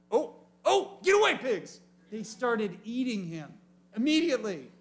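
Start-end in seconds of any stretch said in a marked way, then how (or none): 0.1-1.8 high, loud shooing cry; alarmed shout. Retold or imitated. Preacher shooing the pigs off the fallen one, loud and high. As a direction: yell at the pigs to back off.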